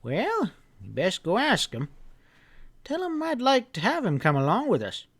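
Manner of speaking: put-on an old storekeeper giving friendly orders; old grandpa voice. Grandpa telling the boy to bring the hounds early, folksy.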